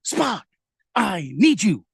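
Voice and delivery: high, pleading Captain Kirk call; lightly hammed-up Kirk begging Spock; a touch of Shatner pleading; William Shatner impression. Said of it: "break out some William".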